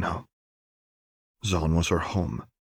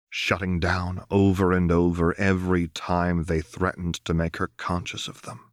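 plain reading voice

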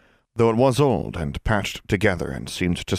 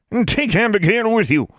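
put-on commanding old crone; 5 dB louder than usual. A narrator's reading. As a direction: bark orders like an old witch. Eldest witch commanding.

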